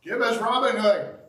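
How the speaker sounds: crowd demand. Retold or imitated. Preacher voicing the crowd calling for Barabbas, loud. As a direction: shout it like a rowdy mob.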